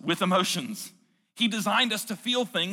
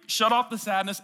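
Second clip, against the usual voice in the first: ordinary talk, nothing marked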